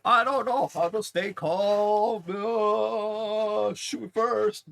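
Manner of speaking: high, loud panicked whining; panicking, slow and desperate; freaking out. Retold or imitated. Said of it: "going full freak out mode".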